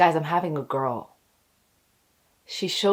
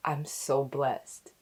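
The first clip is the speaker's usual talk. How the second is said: hushed, slow and low; moved, tearful gratitude; 5 semitones lower, 8 dB quieter, slower than usual. Retold or imitated. Mother recalling news of a girl, moved and hushed. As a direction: let each word out softly, slowly.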